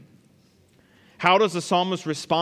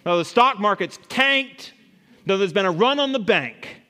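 loud, raised recital of disasters; modern-day lament; 5 semitones higher, 6 dB louder than usual. Acted. Preacher translates Habakkuk for modern America, declaimed lament. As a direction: proclaim each financial blow with mock-scripture weight. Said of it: "it'd be like".

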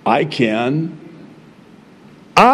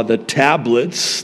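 ordinary talk

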